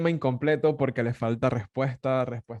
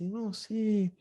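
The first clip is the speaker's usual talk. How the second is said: put-on swooning over his accent, higher and higher; gushing Argentine woman; 9 semitones higher, slower than usual. Argentine woman praises his accent, gushing and very high. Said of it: "Y me dice".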